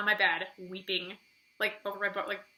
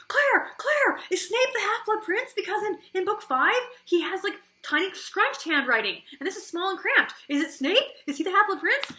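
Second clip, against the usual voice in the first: high, louder eager questions to sister; excited pleading; 9 semitones higher, 4 dB louder than usual. Retold or imitated. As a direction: urgently beg your sister for the answer. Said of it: "and I was like".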